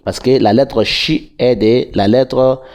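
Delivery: usual speaking voice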